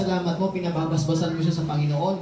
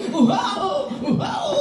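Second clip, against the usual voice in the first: much higher squealing mock cry, laughing; Japanese baby; 12 semitones higher than usual. Pastor joking about a Japanese baby, high mock cry. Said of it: "Hapon, Hapon".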